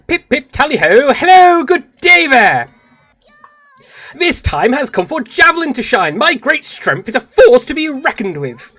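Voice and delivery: high, loud, over-the-top British heroine; ultra British. Acted. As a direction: burst in with hammed-up British cheer. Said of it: "I might need to go ultra British".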